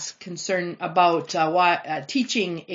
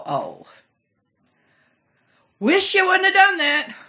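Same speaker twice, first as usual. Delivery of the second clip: exasperated groan; 11 semitones higher, 4 dB louder than usual. Retold or imitated. Reader reacting to neighbors' mistake, mock dismay. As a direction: sound dismayed at a bad decision.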